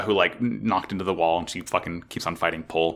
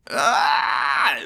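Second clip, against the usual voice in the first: shrill hammed-up villain shriek; high mock battle scream; 5 semitones higher than usual. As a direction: scream it like an anime villain attacking. Pol's attack acted as a scream.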